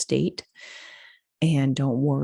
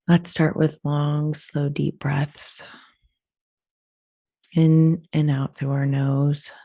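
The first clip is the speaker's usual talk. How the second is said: slow breathing cues with long pauses; guided meditation. Acted. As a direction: lead them unhurriedly through each breath.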